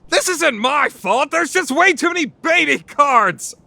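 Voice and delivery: high protest. Acted. Defensive outburst.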